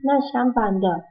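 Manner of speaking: ordinary talk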